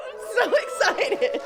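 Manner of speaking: high, laughing with joy; excited laugh. Real, not acted. Mother sharing her son's wedding news, laughing. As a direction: gush with a laugh.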